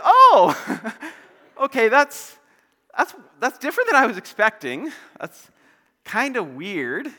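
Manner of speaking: high, loud, awkward laughing reaction; mock-polite bewilderment with nervous laugh; taken aback. Acted taken-aback reaction to odd claim.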